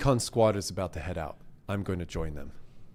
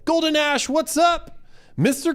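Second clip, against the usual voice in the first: excited; 5 dB louder than usual. Real, not acted. Greeting a viewer excitedly.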